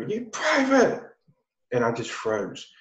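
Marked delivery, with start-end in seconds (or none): 0.0-1.0 imitated sergeant's high, drawn-out call; drill sergeant calling. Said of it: "he calls me over".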